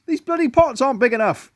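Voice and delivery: high loud call across the garden. A narrator's reading. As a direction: shout for Joyce from the flower beds. Husband complaining loudly.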